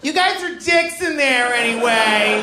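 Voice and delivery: high, drawn-out strained comic voice; mock Mexican at the border. Mexican at the border telling off America, high mock yell. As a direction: plead then cuss in a high, stretched pitch. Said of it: "this is a Mexican at the border".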